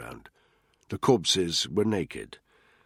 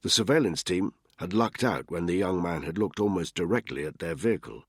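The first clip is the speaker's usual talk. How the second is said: usual speaking voice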